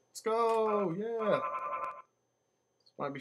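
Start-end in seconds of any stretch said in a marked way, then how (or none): 0.1-1.4 high, drawn-out cheer; excited cheer. Real, not acted. Player as the ride gets going, high excited cheer.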